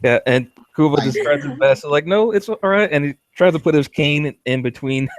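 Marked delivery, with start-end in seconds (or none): 2.0-2.9 당황해서 높게 우기는 말투; high flustered insisting. Acted. Kumba insisting he is fine.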